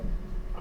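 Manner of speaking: quick unvoiced gasp; imitated sharp gasp. Old neighbors hearing about the calf, gasping. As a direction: suck in air in shock. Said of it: "they went".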